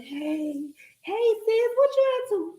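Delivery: high, bright phone greeting; mock cheerful sister. Sister greeting her sis on a late call, high and cheery. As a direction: sing out hey sis like a happy sister.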